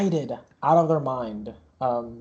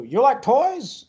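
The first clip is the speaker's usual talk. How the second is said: higher-pitched, drawn-out enticing question; carnival barker; 10 semitones higher, 4 dB louder, slower than usual. Acted. Barker looks at you offering toys, slow enticement. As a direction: tempt a child like a fairground showman.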